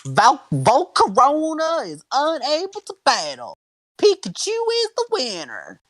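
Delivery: very high, slow, hammy verdict; referee announcer voice. Acted. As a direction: declare the winner like a cartoon referee. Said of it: "I love this voice".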